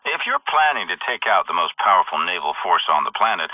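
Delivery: ordinary delivery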